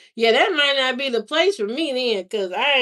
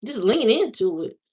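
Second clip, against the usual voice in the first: ordinary talk, nothing marked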